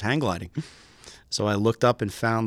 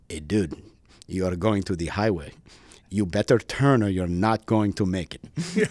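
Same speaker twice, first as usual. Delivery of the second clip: put-on heavy Greek accent. Instructor warning his student over the radio, heavy Greek accent. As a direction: imitate a Greek instructor, pausing between phrases. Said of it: "in a very heavy accent".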